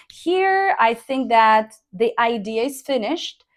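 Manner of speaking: normal talking voice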